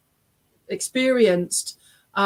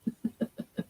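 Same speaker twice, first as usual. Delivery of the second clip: soft, low-volume chuckle; quiet laugh; 12 dB quieter than usual. Real, not acted. Medium amused by the husband's message, laughing quietly. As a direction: laugh softly to yourself. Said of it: "I'm just laughing".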